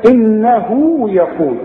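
ordinary delivery

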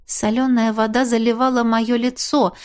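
ordinary delivery, nothing marked

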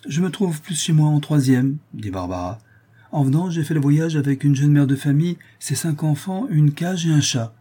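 put-on young woman voice. Barbara voiced, higher.

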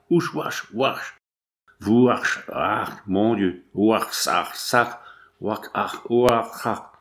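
hammed-up gagging between gasped oaths; retching and spitting. A narrator's reading. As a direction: act out heaving up a poisoned drink. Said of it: "se débarrassant l'estomac, crachant tant qu'ils pouvaient".

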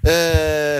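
ordinary delivery

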